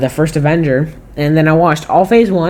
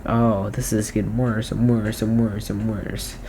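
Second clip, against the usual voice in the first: disappointed; 6 semitones lower, 10 dB quieter than usual. Retold or imitated. Retold disappointment in Marvel.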